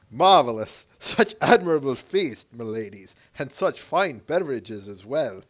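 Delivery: put-on lightly done Irish accent, hearty; a hammed-up Irish accent for a feasting god; terrible Irish accent. Thor praising the feast in a bad Irish accent. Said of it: "That terrible Irish accent".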